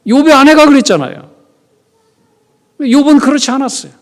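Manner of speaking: loud, high fervent sermon; preaching at full force. Acted. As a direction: preach it with rising fire. Prédication enflammée.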